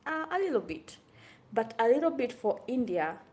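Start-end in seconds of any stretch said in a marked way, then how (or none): 0.0-1.0 mock waiter downplaying. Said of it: "And he goes like".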